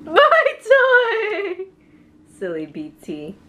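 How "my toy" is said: high squeaky princess voice; cartoon princess. Playing with toy, high voice.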